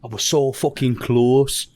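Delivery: slow, put-on Brummie gangster, unshouted; restrained mock gangster impression, slowly; Thomas Shelby. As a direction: stretch it out in a shaky attempt at Birmingham accent. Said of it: "I don't actually know what accent that is either".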